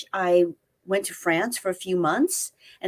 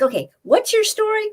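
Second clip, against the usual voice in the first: lightly voiced boss, much higher and louder; a hint of an eager bureau chief asking for a pitch; mock-bright boss; 4 dB louder than usual. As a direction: perk up like a boss suddenly interested. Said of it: "he's like".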